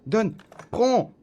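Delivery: loud, high yelps; urgent shout. Real, not acted. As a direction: snap it out in a hurry. Urgence, prendre la carte. (cris pressés pour attraper la carte)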